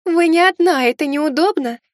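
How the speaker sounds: high melodic voice from behind the mirror; lightly voiced ghost maid behind glass; shimmering echoing mirror voice. A narrator's reading. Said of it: "донёсся мелодичный голос из-за зеркала".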